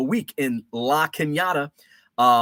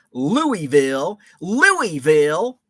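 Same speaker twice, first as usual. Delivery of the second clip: slow, drawn-out northern "Louisville", every vowel sounded; Yankee pronunciation; 3 semitones higher, 6 dB louder, much slower than usual. Shown as a demonstration. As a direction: say "Louisville" like a Yankee, lou-ee-ville. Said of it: "And I say it like a, a true Yankee".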